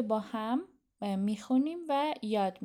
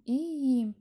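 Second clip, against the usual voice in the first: normal talking voice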